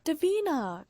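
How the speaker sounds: high excited call of a name; calls out gleefully across the room; child's shout. A narrator's reading. Little girl shouting for Davina. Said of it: "Claire's voice shouted".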